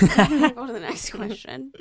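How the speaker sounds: slow, exasperated drawl; teasingly weary plea; drawn out plea. Real, not acted. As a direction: stretch it out, fed up.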